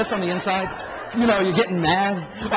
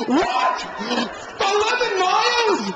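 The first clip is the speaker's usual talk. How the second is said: screaming; 12 semitones higher than usual. Retold or imitated. Yelling at the gate agent, outraged. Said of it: "I'm screaming at the top of my lungs".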